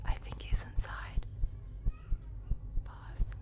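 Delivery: hushed whisper in hiding; whispering while hiding. Real, not acted. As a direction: whisper so he won't hear. Hiding from killer in game.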